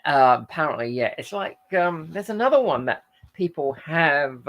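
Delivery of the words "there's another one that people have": ordinary talk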